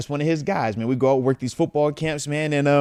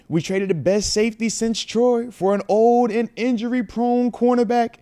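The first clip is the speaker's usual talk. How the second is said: exasperated fan; 9 semitones higher than usual. Retold or imitated. Reading a fuming fan's super chat aloud, exasperated. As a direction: read the fan's rant with growing outrage. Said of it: "He says".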